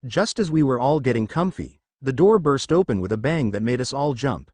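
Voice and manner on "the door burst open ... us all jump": ordinary delivery, nothing marked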